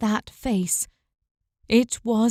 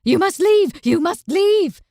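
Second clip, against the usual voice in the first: raised, urgent call to leave; loud warning; 7 semitones higher, 7 dB louder than usual. Acted. Old man shouting at Una to leave. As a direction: order her out, loud and high. Hooded stranger warns her away.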